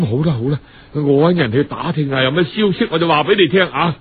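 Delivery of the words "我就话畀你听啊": ordinary delivery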